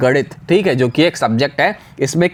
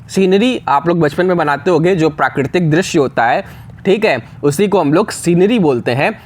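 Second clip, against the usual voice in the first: normal talking voice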